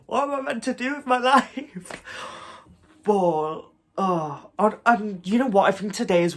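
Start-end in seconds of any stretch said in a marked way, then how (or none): 0.1-2.0 high-pitched playful lament; comic end-of-the-world despair; mock despair. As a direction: wail it lightly, half laughing. Mock despair once it's over.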